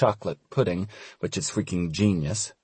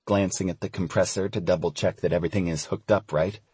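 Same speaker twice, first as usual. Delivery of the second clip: plain reading voice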